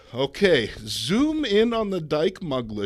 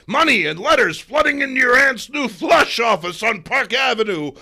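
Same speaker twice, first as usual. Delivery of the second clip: loud, high gloating editor's rant; a newspaper boss shouting at his photographer; 10 semitones higher, 11 dB louder than usual. Acted. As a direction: bellow with greedy glee. Jameson gleeful ranting.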